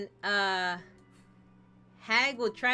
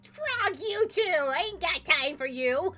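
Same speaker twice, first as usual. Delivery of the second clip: high, loud, sassy brush-off; sassy hag; 7 semitones higher, 4 dB louder, slower than usual. Acted. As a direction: dismiss him with attitude. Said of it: "And she's like".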